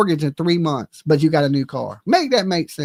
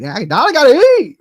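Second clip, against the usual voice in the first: higher, louder mock plea to eat; hangry whine; 7 semitones higher, 7 dB louder than usual. Retelling being hangry, a loud whiny plea for food. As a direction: whine like you're starving. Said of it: "I'm like".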